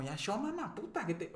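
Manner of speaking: queja irritada imitada, aguda sin gritar; enojo con mamá. Retold or imitated. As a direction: recrea la impaciencia con tu mamá, en tono alto. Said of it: "yo más me enojaba". (hijo fastidiado, enojo contenido)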